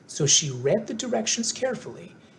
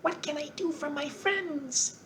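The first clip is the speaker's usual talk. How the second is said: high, soft squeaky mouse queen; squeaky little voice; 13 semitones higher, 6 dB quieter, slower than usual. A narrator's reading. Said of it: "in her squeaky little voice".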